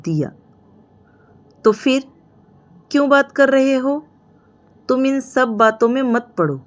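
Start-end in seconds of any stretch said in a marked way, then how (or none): 1.6-6.6 higher, mildly heated scolding; telling him off to stay out of it; angry. A narrator's reading. Sister-in-law scolding the boy to keep out, angrily. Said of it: "Shivani ने गुस्से में बोला".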